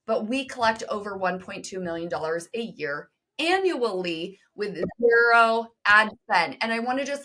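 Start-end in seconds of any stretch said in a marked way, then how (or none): 4.5-6.6 "zero" hit louder and drawn out slowly; stress on zero ad spend. Real, not acted. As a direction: lean hard on "zero ad spend", slow and weighty. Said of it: "hope you hear the zero ad spend part".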